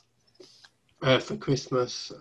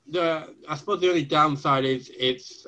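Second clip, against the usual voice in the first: ordinary delivery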